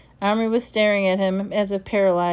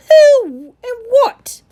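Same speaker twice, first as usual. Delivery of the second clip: bewildered cry; 11 dB louder, much slower than usual. Acted. Secretary bewildered.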